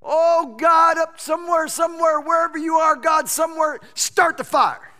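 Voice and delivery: hammed-up pleading prophets crying out for fire; desperate mock shouting. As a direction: yell around searching for a missing god. Prophets of Baal desperately calling.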